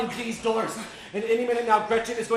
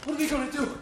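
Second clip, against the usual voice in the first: ordinary talk, nothing marked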